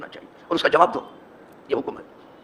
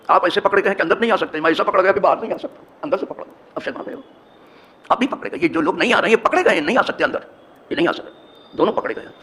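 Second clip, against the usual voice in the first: rising, louder sermon voice, driving the point home; forceful preaching; 4 semitones higher, 4 dB louder than usual. Acted. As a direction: preach it hard to the congregation. (زور دار خطابت)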